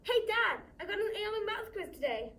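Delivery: bright shout, high and eager; calling out to Dad. Acted. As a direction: share good news with Dad, voice lifted. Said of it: "I want to say".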